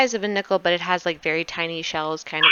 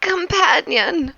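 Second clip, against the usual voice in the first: servant voice; 8 semitones higher, slower than usual. Acted. Servant Anton names himself.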